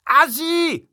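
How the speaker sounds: high, loud jeer; high-pitched heckler's call; heckle from the crowd. A narrator's reading. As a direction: shout the insult out from the crowd. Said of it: "tönte es aus der Menge".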